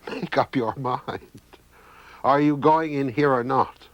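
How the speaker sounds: a mad brother losing patience with his dinner; crazy brother scolding food. A narrator's reading. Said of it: "the crazy brother will suddenly say to his plate of food".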